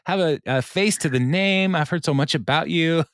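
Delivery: high, gushing friendliness, pitched well up; sugary teacher greeting a new face; overly nice. Retold or imitated. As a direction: lay the niceness on thick. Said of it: "is overly nice".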